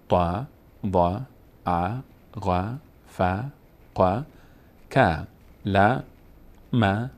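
"ب" and each letter read slowly with a short "a" vowel, like ba, ta, tha; careful teacher's recital of each letter with a short a; letters read with fatha. Shown as a demonstration. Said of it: "به مانند".

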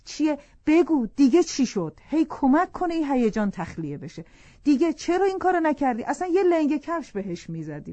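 hammed-up nosy wife badgering her husband; nagging questions. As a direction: pester her to spill it all, half mock. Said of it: "کننده به خانم میگه".

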